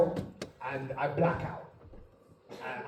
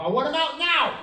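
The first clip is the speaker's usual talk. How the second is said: high-pitched demanding cry; mock tantrum demand; delirious insistence; 9 semitones higher than usual. Patient insists on extraction.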